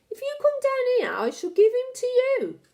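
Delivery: high, loud, eerie witch voice. Acted. Witch tempting the boy with the snake, high and eerie. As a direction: dangle the bait in a high eerie croon. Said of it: "Her voice had a curious rasping quality".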